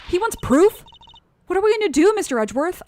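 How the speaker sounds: high, loud and worked up; acted agitated outburst. Character pleads with Mister Edgeworth over proof, agitated. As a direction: blurt it out in high-pitched alarm. Game dialogue, panicked reply about proof.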